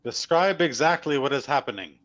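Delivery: loud, high-pitched demand; calling out. Acted. Casimir demanding details of the statue, calling out. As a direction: shout a firm order across a distance. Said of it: "you hear Casimir call out".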